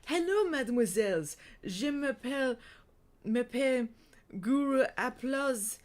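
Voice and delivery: loud, theatrical faux-French creature; thick French accent. A narrator's reading. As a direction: introduce yourself grandly in a badly faked French accent. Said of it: "creature said in a thick, très joli accent".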